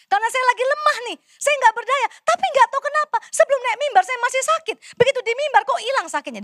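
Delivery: high, fast preaching with rising fervour. Acted. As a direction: build the testimony with urgency. Preaching builds in intensity.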